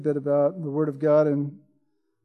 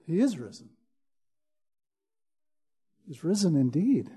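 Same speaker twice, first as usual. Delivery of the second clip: hushed proclamation; 2 semitones lower, 6 dB quieter than usual. Acted. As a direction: drop to a near-hush of awe. Hushed declaration after climax.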